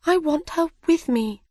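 put-on high-pitched, wistful girl; long for a friend in a high voice; Alice character voice. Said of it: "said Alice".

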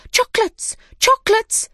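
very high-pitched clamouring; high, loud excited chant; excited cries. Acted. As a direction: squeal it like a crowd of eager little animals. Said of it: "they cried".

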